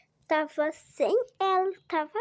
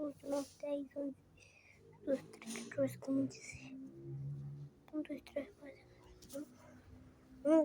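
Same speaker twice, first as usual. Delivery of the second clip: soft, murmured counting to herself; counting under breath; 4 semitones lower, 11 dB quieter than usual. Real, not acted. Child counting softly to herself while playing. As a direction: count quietly, almost whispering.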